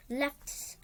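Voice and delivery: plain reading voice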